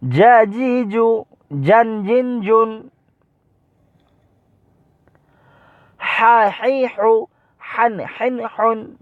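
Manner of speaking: high, level-toned syllable chant; quick recitation drill. Shown as a demonstration. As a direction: recite the short syllables for pupils to copy. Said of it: "আমি যে পড়তেছি".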